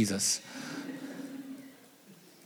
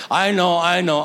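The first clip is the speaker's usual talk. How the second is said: louder, impatient brushing-off; exasperated; 4 semitones higher, 6 dB louder than usual. Retold or imitated. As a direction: cut the old man off. Son's exasperated reply.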